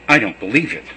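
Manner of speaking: defiant disbelief. Acted. Thomas refuses, doubting.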